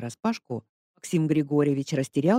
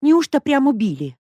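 much higher and louder, scandalised; old lady can't believe it; old neighbour, horrified, asking loudly; shocked gasp; 11 semitones higher, 9 dB louder than usual. A narrator's reading. Old woman hears of murder.

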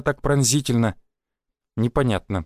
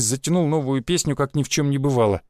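plain reading voice

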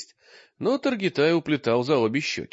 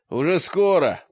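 loud, higher, gruff cheering-on; grim encouragement; 5 semitones higher, 5 dB louder than usual. A narrator's reading. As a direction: urge them on with grim satisfaction. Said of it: "подбодрил Мрак с угрюмым удовлетворением". (подбадривая с угрюмым удовлетворением)

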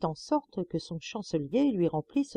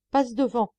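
ordering; 6 semitones higher, 4 dB louder than usual. A narrator's reading. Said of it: "ordonna le très Saint-Pierre".

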